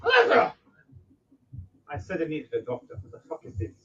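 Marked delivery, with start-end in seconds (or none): 0.0-0.5 a high yell for Alexa; calling out. Acted. The NPC calls out for Alexa, high and loud. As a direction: call her name across the room.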